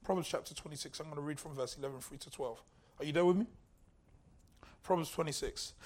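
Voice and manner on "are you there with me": usual speaking voice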